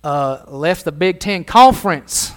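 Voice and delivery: mock Texan drawl, stretched out; hammed-up Texan southern accent. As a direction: put on a slow Texas accent. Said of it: "They got that southern drawl".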